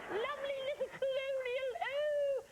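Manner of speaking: put-on very high, slow, theatrical old dame voice; Dame Edna impression. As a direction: camp it up like a grand Aussie dame, drawn out. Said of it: "my British accent sounds like Dame Edna".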